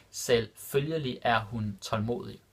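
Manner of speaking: Selvfølgelig er hun tålmodig drawn out slowly, each syllable clear. Shown as a demonstration. Teacher repeating the Danish example for learners, slow and clear. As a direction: stretch it out so learners hear every sound. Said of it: "repeated slower for learners".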